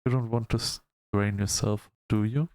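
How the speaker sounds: ordinary delivery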